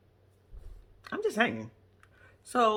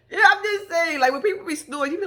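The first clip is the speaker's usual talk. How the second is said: cry-laughing, high and loud; laughing hard; 9 semitones higher, 9 dB louder than usual. Real, not acted. To viewers after the snoring joke, cry-laughing. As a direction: talk through a wheezy laugh.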